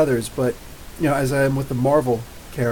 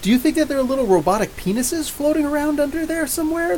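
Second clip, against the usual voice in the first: ordinary delivery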